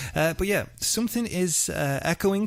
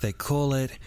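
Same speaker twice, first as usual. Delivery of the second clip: hushed documentary voice opening a murder story; dramatic podcast intro; 4 semitones lower than usual. Acted. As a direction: read it like a true-crime trailer. Dramatic true-crime intro read.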